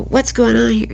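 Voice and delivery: loud, high demand from an arriving cop; officer demanding. Retold or imitated. Police officer entering the house, loudly demanding what's going on. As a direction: bark it out as the officer at the door.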